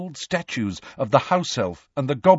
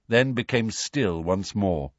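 usual speaking voice

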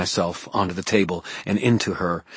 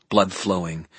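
ordinary delivery, nothing marked

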